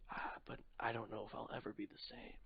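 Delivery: hushed, drained whisper; shaken whisper. Acted. Shaken hero whispering to the wizard, barely audible. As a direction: whisper it like someone hollowed out by what happened.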